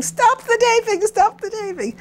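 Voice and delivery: hammed-up terrified cry; terrified yelling, imitated. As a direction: imitate a panicked man calling out way up high. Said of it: "ran down terrified in his undershorts yelling".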